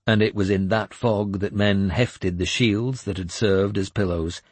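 plain reading voice

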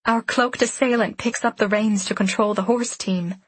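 ordinary delivery, nothing marked

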